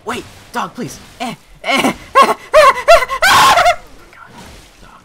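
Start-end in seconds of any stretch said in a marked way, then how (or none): 2.0-4.1 high shrieking burst of laughter; loud laugh. Real, not acted. Gamer cracking up at his own fail, shrieking laugh. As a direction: cackle loud and high at the screen.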